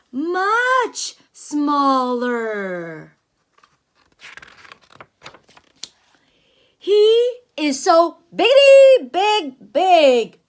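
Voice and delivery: booming storybook whale; big whale voice. Acted. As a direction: play the big whale bragging about his size. Reading the big whale's lines.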